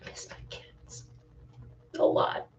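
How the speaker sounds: choked whisper, near tears; tearful whisper. Real, not acted. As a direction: whisper it, fighting back tears. Breaks down missing her kids.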